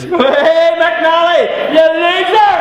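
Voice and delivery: high mocking yell across the room; jeering shout. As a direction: taunt him from far across the dance floor. Friends jeering across dance floor.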